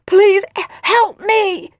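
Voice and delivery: high, loud, melodramatic cries for help; mock dying plea. A narrator's reading. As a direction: gasp and wail as if fatally poisoned. Feigns being poisoned, begs for help.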